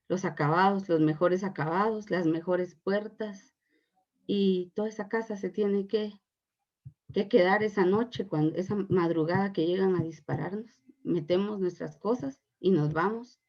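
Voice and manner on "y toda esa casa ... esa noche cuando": ordinary delivery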